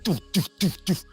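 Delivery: mimicked pounding heartbeat; heartbeat thumps. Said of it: "My heart was like".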